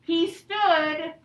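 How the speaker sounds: slow, clear storybook reading; patient read-aloud to young pupils; reading to children. A narrator's reading. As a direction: read it out slowly for the class.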